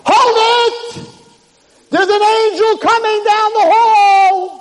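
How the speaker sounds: very high, loud shout; urgent cry that halts the court; screaming announcement. Retold or imitated. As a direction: scream it down a long hall, stretching the end. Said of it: "there's a scream in the Beis Din Shalmaila".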